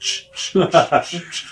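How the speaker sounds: short hushing scuff noises; imitated sliding boots. Wrestler mimicking his boots going across the floor, sharp shushing. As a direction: make quick "shh" sounds for slippery soles.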